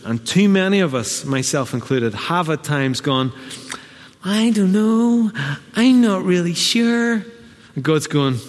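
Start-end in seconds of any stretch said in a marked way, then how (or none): 4.2-7.2 higher, slow dithering voice; hammed-up dithering, higher and slow; mock unsure believer. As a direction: play the hesitant doubter. Mock doubtful believer quote.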